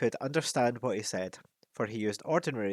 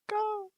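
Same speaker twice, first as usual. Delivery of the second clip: high croaking call; a short bird cry; raven croak. Acted. Raven punctuates his speech with a high croak.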